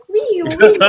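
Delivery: high, loud laughing "wee"; gleeful rising squeal breaking into laughter; playful wee. Acted. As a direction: whoop like riding a bumpy motorbike. Imitates motorbike bouncing on bad roads.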